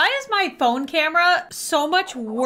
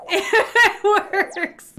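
laughing; 6 semitones higher than usual. Real, not acted. Delighted that finger trick works.

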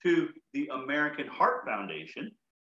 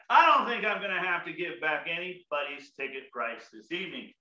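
normal talking voice

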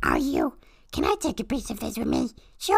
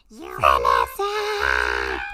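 drawn-out shout across a distance; long calling shout; 4 dB louder, much slower than usual. Acted. Bee calls out to Vanessa across the park, long shout. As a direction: call her name slowly and loudly.

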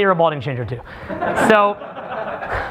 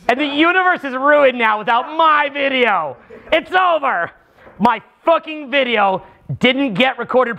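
higher-pitched mock fury over the ruined video; demo rant; 5 semitones higher than usual. Shown as a demonstration. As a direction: rant about the lost video in a raised, whining pitch. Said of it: "think of something in R C that just really would piss me off".

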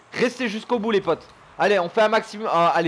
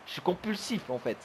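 usual speaking voice

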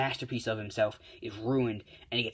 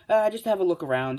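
high, loud, breezy reply; acted carefree reassurance, raised voice; casual call-back; 7 semitones higher, 7 dB louder than usual. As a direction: toss it back to friends while leaving. Jack calls back while floating off.

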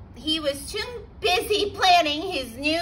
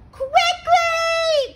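high, loud shout of alarm; urgent cry; 14 semitones higher, 6 dB louder than usual. A narrator's reading. Third pig calling brothers in, high urgent shout. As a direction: yell to brothers to hurry. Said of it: "cried the third little pig".

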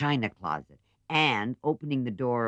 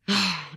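weary sigh; 7 semitones higher, 4 dB louder than usual. Acted. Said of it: "Meg sighs in pain".

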